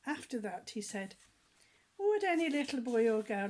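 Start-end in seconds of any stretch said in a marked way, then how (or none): 2.0-3.5 louder voiced clown invitation; Humpty Dumpty asking. A narrator's reading. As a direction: invite the kids up like a panto clown.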